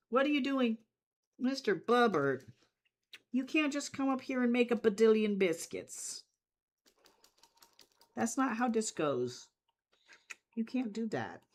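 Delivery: high, loud mock-scolding of a pet. Real, not acted. Scolding the cat on keyboard.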